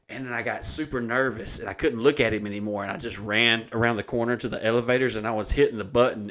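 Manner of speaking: usual speaking voice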